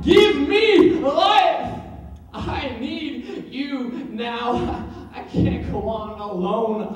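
high, loud cry, then slow and drawn out; wailing plea. Acted. Lost man wailing to God for life, drawn-out plea. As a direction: wail the first words, then let it sag. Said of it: "I wailed aloud".

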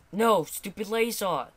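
usual speaking voice

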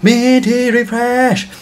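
slow hyped-up announcer. Real, not acted. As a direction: draw it out like a hype man. Hyped intro to chart.

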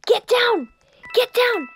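loud, urgent call to duck; urgent shout. Real, not acted. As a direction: yell at them to get down. Urging friends to hide.

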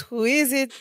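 mock Mexican accent on the question. Co-host retells Larry asking who knocks, Mexican accent. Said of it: "in a Mexican accent".

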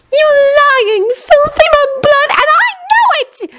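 high, furious witch voice; Bellatrix voice. A narrator's reading. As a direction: screech the insults. Bellatrix yelling from above.

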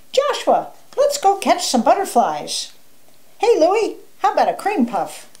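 high, playful calling out; happy wolf. A narrator's reading. Happy wolf calls his friends to play, excited.

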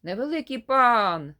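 loud demanding shout from outside the door; a tiny old man yelling to be let in. Acted. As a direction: bellow it through the door like a bossy little gnome. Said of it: "кричить".

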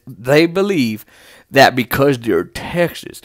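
low Texan drawl; lightly put-on Texan accent. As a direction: slip into a Texas accent, drawn out. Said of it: "always go into that accent".

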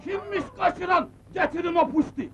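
high-pitched indignant ordering; angry demand. Acted. Agha ordering the bride's kidnapper brought to him, angry. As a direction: demand it high, as an outraged boss.

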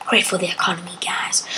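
hushed whispered aside. Real, not acted. Joking to viewers about the economy, whispered. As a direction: whisper the joke to the camera.

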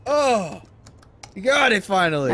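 loud, high shout of relief; relieved triumph. Real, not acted. Player finally got it, relieved triumph.

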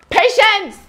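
high, loud call across store. Real, not acted. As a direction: shout a name across the shop. Calling colleague across store.

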